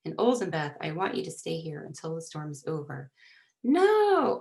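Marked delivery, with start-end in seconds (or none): 3.6-4.4 high, drawn-out cry of refusal; loud protest. A narrator's reading. Girl refusing to stay behind in the storm, loud protest. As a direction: wail it out in protest. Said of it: "Olzenbeth protested, bursting into sobs".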